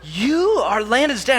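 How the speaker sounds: put-on child floored at learning he is the dad; slow stunned disbelief. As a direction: mimic the girl turning slowly, floored. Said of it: "turned to me very slowly".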